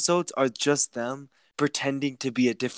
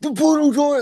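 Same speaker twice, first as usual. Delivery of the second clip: high, loud gasping outrage; outraged shout; 5 dB louder than usual. Retold or imitated. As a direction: shriek it like outraged fans.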